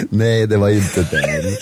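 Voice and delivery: slow, laughing denial. Real, not acted. Singer correcting co-host's silly song guess, laughing. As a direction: say it through laughter, drawn out.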